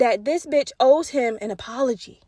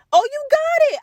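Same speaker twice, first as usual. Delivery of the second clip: excited squeal; 14 semitones higher, 3 dB louder than usual. Real, not acted. Excited reaction in story.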